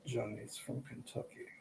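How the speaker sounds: slow, very soft Depp-like British-Kentucky lilt; British-Kentucky accent, hushed. Retold or imitated. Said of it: "Kentucky British accent".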